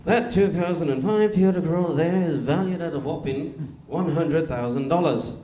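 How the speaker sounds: put-on loud, grand English-accented sales pitch; English car salesman. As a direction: sell it hard in an English accent, drawing out the price. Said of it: "An English car salesman?".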